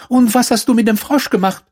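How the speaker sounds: very high princess demand; alarmed princess. A narrator's reading. Princess asks the stranger about her frog, high alarmed demand. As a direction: question him urgently, up high.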